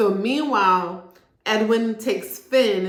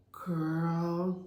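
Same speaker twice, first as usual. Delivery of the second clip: put-on pitying girl; 2 semitones lower, 8 dB quieter than usual. Speaker reacting to Paige's denial.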